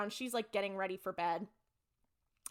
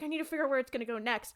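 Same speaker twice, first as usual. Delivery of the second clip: rushed; 3 semitones higher, much faster than usual. Retold or imitated. Bobby's line repeated faster.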